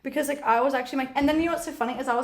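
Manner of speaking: usual speaking voice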